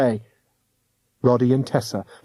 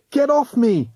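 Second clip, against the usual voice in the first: high struggling yell; 11 semitones higher, 3 dB louder than usual. A narrator's reading. Roddy fighting off the ghost hunter, high yell. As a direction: yell while wrestling free. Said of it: "Roddy yelled".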